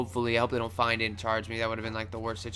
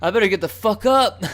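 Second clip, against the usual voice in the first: higher, loud retold urgency; urgent self-warning; 9 semitones higher, 10 dB louder than usual. Telling himself to flee the mob, urgent. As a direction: blurt it out, high and loud. Retelling panic as mob approaches.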